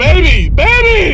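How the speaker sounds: desperate high shout; calling out. Acted. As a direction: yell after her, voice cracking high. Calling after girlfriend hanging up.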